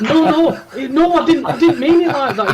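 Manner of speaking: high, laughing backpedal; flustered laughing denial. Retold or imitated. Desk worker flusters to the guest, high nervous laughing.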